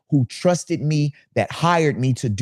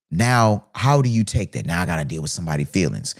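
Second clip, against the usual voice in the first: ordinary delivery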